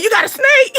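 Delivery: high, squeaky disbelieving question; shocked disbelief. Retold or imitated. Telling a friend with a snake, squealing shocked disbelief. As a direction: voice leaps up in horror to a friend who owns a snake. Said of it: "I'm be like".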